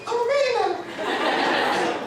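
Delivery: very high, drawn-out squeal; squeaky teenage boast. Retold or imitated. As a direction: stretch it out in a cracking adolescent squeak. Squeaky redo of teenage claim.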